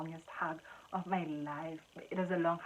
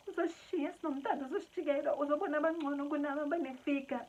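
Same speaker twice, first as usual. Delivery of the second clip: high, mocking sing-out of worries; exaggerated high-pitched whining; childish whine; 8 semitones higher than usual. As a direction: mimic a whiny, insecure child. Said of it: "I was so childish about the whole thing".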